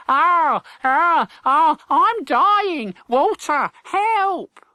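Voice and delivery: high, loud fake cries of pain; hammed-up death throes to fool a stranger; pretend dying cry. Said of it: "began to cry out".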